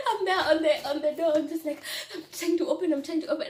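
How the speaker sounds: high, laughter-filled retelling; laughing. Real, not acted. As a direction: tell it while cracking up. Laughs retelling her panic.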